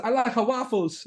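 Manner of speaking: high goofy voice reading a chat line; mock dumb voice. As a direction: play the clueless guy rambling. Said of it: "he said".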